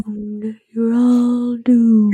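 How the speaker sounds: slow ominous raspy drawl; ominous doom prophet. Retold or imitated. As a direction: drag out the doom warning.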